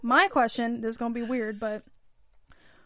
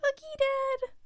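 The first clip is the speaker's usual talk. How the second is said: put-on high, slow little boy voice; son's reply; 13 semitones higher, 4 dB quieter than usual. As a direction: answer like a trusting little boy. Son answering his father in story.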